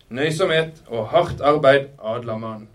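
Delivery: slow, loud oratory; pompous, drawn-out declamation; declaiming. A narrator's reading. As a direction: preach the motto to a crowd. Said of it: "deklamerte han til forsamlingen".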